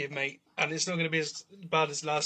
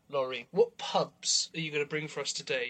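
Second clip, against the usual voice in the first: ordinary talk, nothing marked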